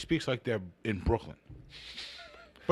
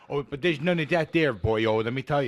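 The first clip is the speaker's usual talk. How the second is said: a light mock Irish lilt, a touch louder; mock Irish boyo; 4 semitones higher, 5 dB louder than usual. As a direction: play a cheeky Irish storyteller. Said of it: "Bobby got me with the boyo".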